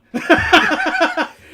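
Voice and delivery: bursting, high-pitched laugh; loud high laugh. Real, not acted. Laughing after the story.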